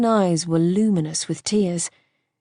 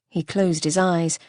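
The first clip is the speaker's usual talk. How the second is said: ordinary delivery, nothing marked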